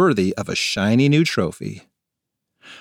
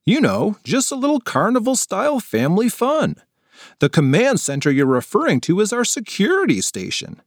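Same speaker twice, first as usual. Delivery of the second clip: higher, kindly explaining; lightly voiced security worker; patient adult; 9 semitones higher than usual. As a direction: set the kids straight gently.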